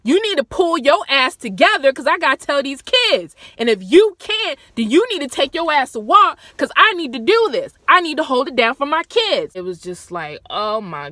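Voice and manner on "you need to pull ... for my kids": loud, firm retold command; firm mother. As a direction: hold it together, loudly laying down the law. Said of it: "She's like".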